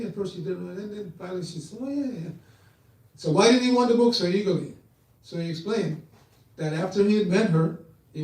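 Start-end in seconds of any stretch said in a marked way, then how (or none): 1.8-2.3 high, soft dawning recall; lightly acted aha moment; sudden recognition. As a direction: imitate someone just remembering, pitched up. She finally remembers the man.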